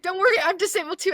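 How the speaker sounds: high, loud, quick reassurance; rushed high-pitched mock reassurance; overeager fan. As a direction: imitate an overexcited stranger. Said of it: "They're like".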